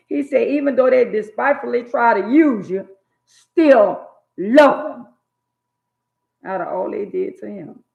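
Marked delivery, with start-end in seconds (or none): 0.1-5.1 higher and louder, stretched out; preaching peak. Real, not acted. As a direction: swell into God's command. Preaching God's words at peak.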